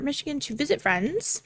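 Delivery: normal talking voice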